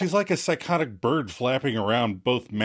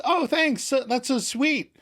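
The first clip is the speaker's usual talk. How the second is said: put-on much higher, sugary girlish voice; mock Katie's syrupy thanks; imitating Katie; 13 semitones higher, slower than usual. Podcast host imitating Katie thanking Rachel, high and sugary. Katie quoted in Friends recap.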